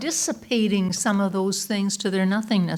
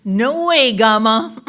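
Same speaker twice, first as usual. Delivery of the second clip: loud shout back over the shoulder; defiant child; 9 dB louder, slower than usual. Retold or imitated. Three-year-old running from his grandma, loud cheeky shout. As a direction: imitate a little kid calling out in defiance.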